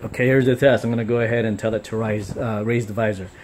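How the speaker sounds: ordinary delivery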